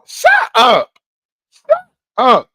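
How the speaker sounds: sudden high loud shout; yelling shut up. Real, not acted. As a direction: cut them off with a sharp yell. Streamer cutting off chatter.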